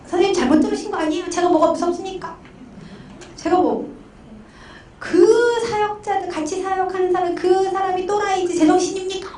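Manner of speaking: high-pitched, indignant denial; mock angry protest. Pastor client denying fear to counselor, high mock outrage. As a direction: imitate a client bristling and blaming others. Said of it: "막 또 화를 내시는 거예요".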